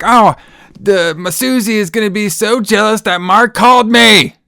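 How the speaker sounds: high, loud mock shriek; mock screaming. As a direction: scream it out like an overexcited girl. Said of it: "just screaming her fucking out".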